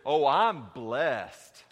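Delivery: very slow, drawn-out pious sing-song; milk the holy answer; churchy blessed. Retold or imitated. Preacher mocking the pat church reply, long and drawn-out.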